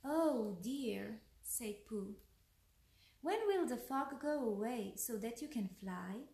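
lively bedtime-tale narration; story read to children. A narrator's reading. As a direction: read a picture book aloud, pausing between lines.

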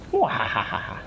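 quiet menacing villain laugh; evil laugh. Acted. Villain gloating over the hero.

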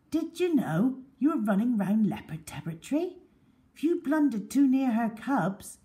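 a hint of a stern ranger; man's stern warning voice. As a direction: tell them off about the danger. Man warns boys about leopards.

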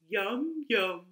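usual speaking voice

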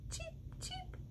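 high, soft little peeping; chick peep. Acted. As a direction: squeak like a tiny chick. Chick sound in story.

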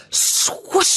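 long hissing whoosh noise; swoosh. A narrator's reading. Narrator imitating the time watch at work, whooshing. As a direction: draw the word out into rushing air. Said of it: "a very loud".

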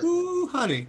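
high, drawn-out mocking ooh; sassy ooh honey. Imitating his sassy reply to a heckling fan, high. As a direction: swish it up like a diva. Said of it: "Uh-uh, honey".

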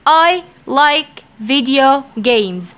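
I like video games said slowly; slow dictation. Shown as a demonstration. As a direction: read it slowly for learners. (نطق بطيء)

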